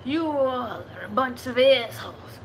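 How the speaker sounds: loud, hammed-up Russian accent. Shown as a demonstration. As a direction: throw a mock insult in a Russian accent. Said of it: "what to say in Russian".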